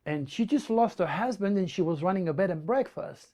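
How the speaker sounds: usual speaking voice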